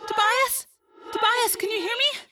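put-on high, distant girl's call; faint, far-away voice. A narrator's reading. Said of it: "It was faint, as if it were far away".

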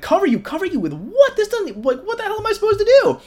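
can't believe what he's asked; incredulous. Retold or imitated. Hadley asked to cover Miles with nothing, incredulous squeak.

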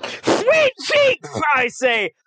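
high, frantic pleading squeak; high squeaky voice. Acted. Player fakes a tiny voice.

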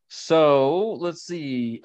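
So let's see stretched very slowly, each word held; drawn-out so let's see. Real, not acted. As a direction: drag out So let's see while thinking. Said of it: "repeated slowly".

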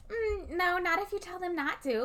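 high-pitched mock-sweet refusal; put-on high, prim contradiction; dismissive grandma. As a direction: imitate a smug grandma brushing it off. Imitating grandma's smug refusal.

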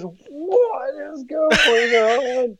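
high, very drawn-out wail; mock horrified wail. Brother walks in on the mess, high mock wail. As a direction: wail it slowly, hammed-up distress.

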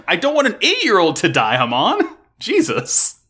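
high, loud exasperated yell at the screen; exasperated outburst. Real, not acted. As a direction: cry out in disbelief at Hamon. Exasperated reaction to the plot.

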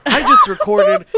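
high, slow, loud exclamation; laughing protest. Real, not acted. Host caught out by the accent complaint, mock outrage. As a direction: protest loudly through the group's laughter.

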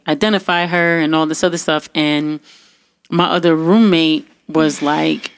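ordinary talk

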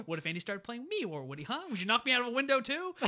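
rising outrage toward the end; angry Potato Head. Retold or imitated. Potato Head confronting Woody, with loud, high outrage. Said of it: "And he's like".

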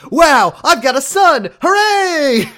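high, loud, slow cheering; mock giddy David. David celebrating his new son, high and loud mock cheer. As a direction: gush it like a kid on his birthday. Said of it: "is just like".

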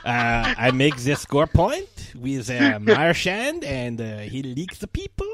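broken English in mock French Canadian; Patrice Bergeron. Hockey star describing his teammates, French Canadian accent. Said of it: "pitch perfect French Canadian accent".

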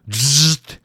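loud buzzing zap; zzzt. A narrator's reading. Narrator voicing an electric current. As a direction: buzz like electricity. Sound effect in story.

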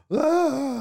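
high mock scream of a panicked kid; imitated scream. Mom mimics daughter's panicked squeal, high mock scream. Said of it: "she comes up screaming".

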